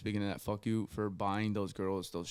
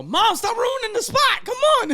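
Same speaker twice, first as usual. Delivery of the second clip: very high, loud yell of exasperation; whining protest; 13 dB louder than usual. Retold or imitated. Son begging mom not to spoil the party, whining protest. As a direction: screech at mom in hammed-up protest.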